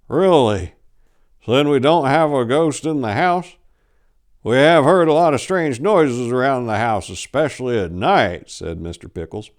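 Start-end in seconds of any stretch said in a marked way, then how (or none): 0.1-8.5 put-on old husband, a little higher and louder; a hint of a puzzled old man; lightly voiced old man.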